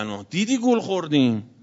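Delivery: normal talking voice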